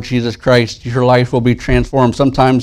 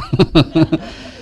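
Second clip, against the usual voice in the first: laugh; 6 semitones higher than usual. Real, not acted. Laughs again.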